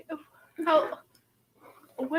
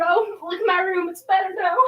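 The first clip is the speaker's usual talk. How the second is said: words squeezed out through laughter; laughing; 5 semitones higher, 9 dB louder than usual. Real, not acted. Friend cracking up at the church joke, laughing.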